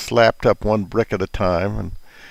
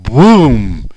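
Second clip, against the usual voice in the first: storyteller's boom; 9 dB louder than usual. A narrator's reading. Wolf's head explodes in story.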